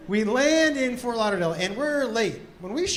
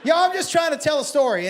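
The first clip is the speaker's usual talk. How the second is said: usual speaking voice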